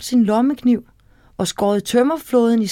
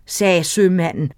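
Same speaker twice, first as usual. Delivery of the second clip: ordinary delivery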